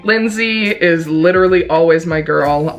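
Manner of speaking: ordinary talk, nothing marked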